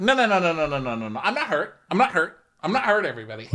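high-pitched string of rapid denials; mock protesting. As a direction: brush it off in a high voice. Acting out Mary's protest.

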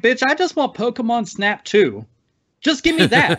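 high, pleading gamer whine; mock whiny demand. Gamer whining to Nintendo for Pokemon Snap two. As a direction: beg like a spoiled fan. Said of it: "I'm like".

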